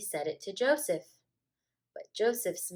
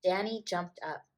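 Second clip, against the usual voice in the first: ordinary delivery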